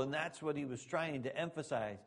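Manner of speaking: ordinary delivery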